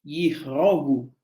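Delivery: yihrogu lento con i inicial; pronunciación babilónica. Shown as a demonstration. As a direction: di yihrogu despacio, marcando la i. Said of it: "sería yihrogu". (yihrogu pronunciado despacio)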